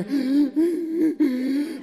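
series of high, loud gasps; imitated child's gasps. Father hears hidden daughter, imitated high gasping. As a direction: gasp repeatedly in a high squeak.

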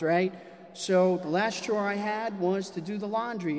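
ordinary delivery

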